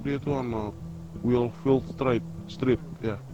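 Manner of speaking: ordinary talk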